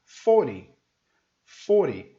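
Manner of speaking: forty as FOR-EE, T gone; loud forty with the T softened away; American vanished T. Shown as a demonstration. Teacher showing American English, forty without a hard T. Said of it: "ele sumiu de novo".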